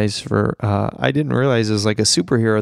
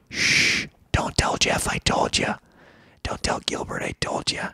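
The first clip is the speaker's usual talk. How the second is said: hushed, unvoiced stage whisper; conspiratorial whisper; 5 dB quieter than usual. Podcaster letting listeners in on Gilbert's secret, playful whisper.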